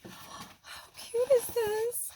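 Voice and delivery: gasp, then high, drawn-out cooing; gushing delight. Real, not acted. Vlogger admiring a gift's little bees, high delighted coo. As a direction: coo slowly over something adorable. Gasping over the gift.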